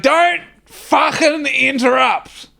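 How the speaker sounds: high, slow mock snarl; angry rebuke. Wayno scolding the teller for interrupting, high slow mock anger. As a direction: impersonate an irate boss cutting someone off. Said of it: "He's like, he's like".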